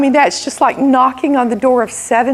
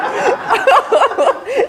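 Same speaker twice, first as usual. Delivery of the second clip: high laugh; 11 semitones higher than usual. Real, not acted. Laughs at own joke.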